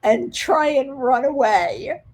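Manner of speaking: ordinary delivery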